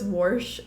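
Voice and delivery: "warsh" with a rising r sound; dad's "warsh" instead of wash. Retold or imitated. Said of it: "My daddy says".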